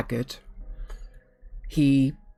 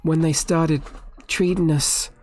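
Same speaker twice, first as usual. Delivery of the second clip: halting, stammered confession building to higher, louder protest; anxious captive patient; 4 semitones higher, 3 dB louder than usual. Acted. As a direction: plead your case as a wronged patient. GM voices frightened captive patient.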